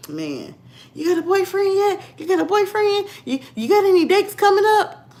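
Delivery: high-pitched eager busybody voice; nagging client's wife. Retold or imitated. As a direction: pester in a high mock-nosy voice. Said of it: "that's how my client wife was. She kept".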